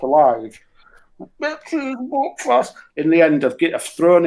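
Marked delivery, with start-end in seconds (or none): 1.4-2.7 high, slow hammed-up Belfast accent; slow mock Belfast accent. To the shopkeeper, slow and high mock Belfast accent. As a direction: drag out the fake Belfast words, pitched up high.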